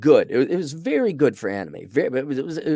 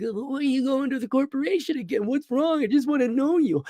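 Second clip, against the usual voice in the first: high, whiny imitation of the hero; whiny boyfriend; 14 semitones higher than usual. Reviewer mocking the clingy hero: whiny high voice. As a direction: whine like a needy boyfriend. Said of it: "he's always whiny".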